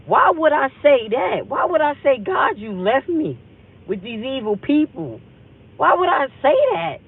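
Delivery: high, loud, incredulous self-questioning; retold disbelief. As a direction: question yourself, aghast at your own words. Retelling her own shocked inner thought.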